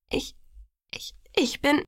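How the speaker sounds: halting, faltering whisper; stammering apology. A narrator's reading. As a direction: falter between words, unable to finish. Ashamed narrator stammers an apology.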